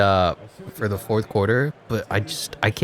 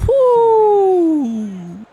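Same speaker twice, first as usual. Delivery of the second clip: loud, high-pitched "woo"; a big drawn-out cheer; excited whoop; 6 dB louder than usual. Real, not acted. Celebrating a play in the game.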